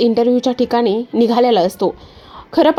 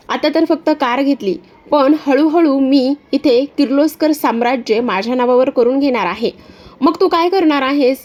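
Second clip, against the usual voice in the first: Disha's sly voice; 4 semitones higher than usual. Acted. Disha calling from her car to Aditya, high and mocking. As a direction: sweet-talk him with a sneer.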